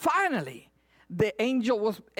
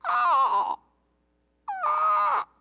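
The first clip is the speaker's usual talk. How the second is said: high harsh raven caw; raven cry. Retold or imitated. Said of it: "a raven's cry".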